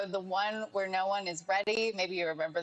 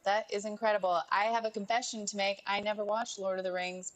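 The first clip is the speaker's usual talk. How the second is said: ordinary talk, nothing marked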